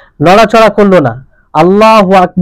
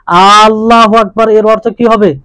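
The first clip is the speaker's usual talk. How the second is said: normal talking voice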